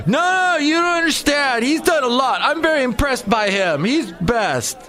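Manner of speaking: mock the gushing Zuckerberg fan, squeaky high; gushing defender. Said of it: "she defends Mark Zuckerberg and says".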